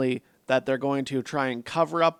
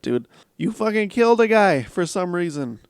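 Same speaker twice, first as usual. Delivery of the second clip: ordinary talk, nothing marked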